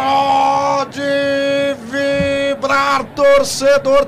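long held goal cry; explosive goal commentary. Real, not acted. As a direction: stretch each word, shouting. Goal celebration call.

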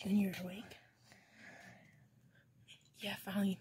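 hushed whisper. Acted. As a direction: whisper so nobody wakes. Sneaking at night.

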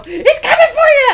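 high, loud mock-scary shout; jump-scare someone with it; spooky yell. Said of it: "my mom decided to go".

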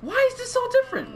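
high, squealing incredulous questions; baffled disbelief. Real, not acted. Player baffled by a character's changed shorts, high incredulous squeal. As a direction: squawk it in high-pitched bafflement.

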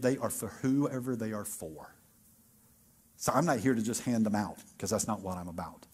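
a promise spoken low and heavy; Jesus, solemn. Acted. Jesus warns his disciples, low and soft. Preacher voices Jesus' reply.

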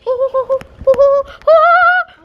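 high, slow mocking ho-ho laugh; evil laugh. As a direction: cackle like a villain. Mock villain laugh.

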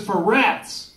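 high, loud schoolboy outburst, stretched out; shouted punchline. Acted. Schoolboy answering the teacher's question, shouted punchline. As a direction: build to a big, drawn-out yell.

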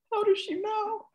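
very high-pitched, put-on astonished voice; mock amazement. Family reacting to mother's uncanny phone call, mock amazed. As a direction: imitate a spooked, baffled chorus in a tiny high voice.

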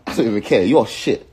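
loud, heated dismissal; angry insult. Real, not acted. Insulting rival in rant.

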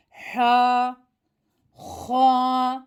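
"H." recited with a breathy, throaty h; reciting letter sound. Shown as a demonstration. Teacher demonstrating the half-thick letter, throaty. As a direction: push air through the throat on "H.". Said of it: "H harfi yarım kalın bir harf".

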